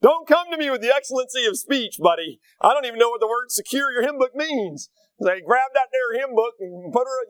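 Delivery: hammed-up plain-talking buddy; imitating Chris. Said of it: "Chris is a simple guy".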